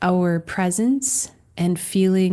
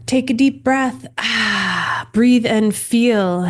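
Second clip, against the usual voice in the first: guided breathing; 3 semitones higher, 7 dB louder, slower than usual. Acted. Leads breathing exercise.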